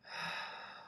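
long soft exhale through the mouth; breathing-out demo. Shown as a demonstration. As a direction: show the out breath. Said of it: "out through your mouth".

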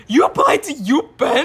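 high, loud, slow incredulous question; mock-astonished outburst; shocked disbelief. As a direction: re-enact being stunned, stretching the words. Retelling shocked reaction to friend.

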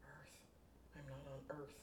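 hushed shock to herself; quiet horror; stunned realisation. Acted.

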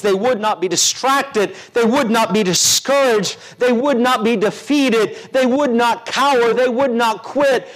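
rising preaching build. Acted. Preacher builds repeated declarations.

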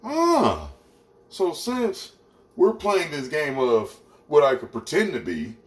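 high-pitched mock pondering; men rationalizing. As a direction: act out a man catching on and reasoning. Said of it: "Way men rationalize".

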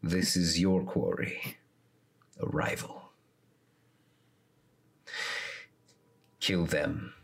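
low, hushed commanding shadow voice; a dark patron giving orders; deep voice. Acted. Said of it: "a deep voice respond".

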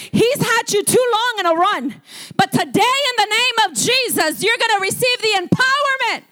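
high, rising proclamation; fired-up preaching. Real, not acted. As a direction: declare victory over the congregation. Preaching at full force.

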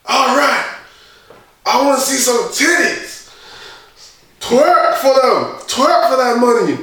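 high, drawn-out hype calls; imitated DJ. DJ urging girls in round two, imitated hype. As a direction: work up the crowd like a DJ. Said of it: "And D J's like".

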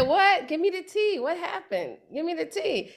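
high-pitched, mock nosy gossip; eager gossip. Teacher mimics friends after gossip, eager and high. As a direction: play the friend hungry for the tea.

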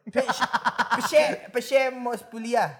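playful stammer, laughing; mock stutter. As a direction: stammer the pse on purpose. Stuttering joke about stutter fetish. (gagap main-main sambil tertawa)

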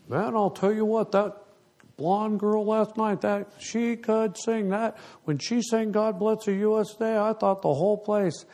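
put-on high-pitched starstruck old guy; imitating old man. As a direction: gush about the blonde singer in a put-on high voice. Old man on cruise praising singer.

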